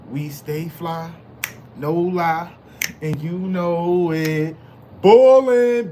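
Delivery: deliberate build to a punched hype call; hype catchphrase. Pretend. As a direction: stretch each phrase out, then belt the final word.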